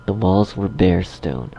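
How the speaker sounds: usual speaking voice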